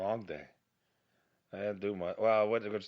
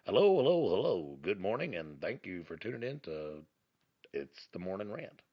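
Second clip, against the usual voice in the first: mock radio host. Podcaster greeting imaginary listeners, mock radio host. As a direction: play the morning radio presenter. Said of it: "like, a voice for radio".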